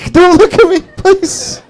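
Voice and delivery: tiny high falsetto begging; mortified squeaky plea. Acted. Exposed man pleading with onlookers, high pitiful mock whimper. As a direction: shrink into a squeaky, helpless whimper, hammed-up.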